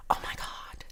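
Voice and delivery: soft breathless whisper of awe; hushed, amazed whisper; awed whisper. Real, not acted. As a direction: whisper it like you can't believe it. Awed by viewer's idea.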